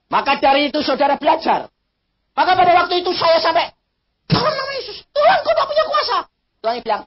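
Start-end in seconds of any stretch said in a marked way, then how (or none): 4.3-6.2 desperate shouted prayer. Retold or imitated. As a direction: yell it out in panic. Preacher shouting rebuke at God.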